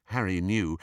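ordinary delivery

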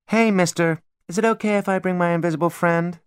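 put-on high, sweet little-girl voice; cutesy voice. A narrator's reading. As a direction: put on a cute little kid act. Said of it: "her best cutesy voice".

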